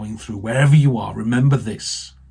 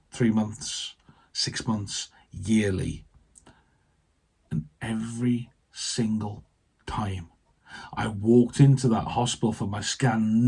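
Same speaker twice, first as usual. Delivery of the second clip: ordinary delivery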